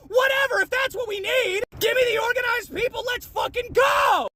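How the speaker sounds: high, fierce yell to the troops; rallying shout. Real, not acted. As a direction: shout them into action, stretching the last word. Rallying followers.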